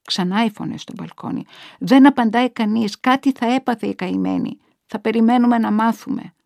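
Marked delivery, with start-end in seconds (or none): 1.8-6.3 raised, louder voice from a balcony; gossiping neighbour. A narrator's reading. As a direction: speculate aloud to the neighbour across. Neighbours talk across the balconies. (φωνή γειτόνισσας στο μπαλκόνι)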